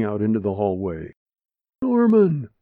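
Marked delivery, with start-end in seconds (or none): 1.8-2.5 high, loud frantic shout for her husband; panicked call. Acted. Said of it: "Ida called out to her husband".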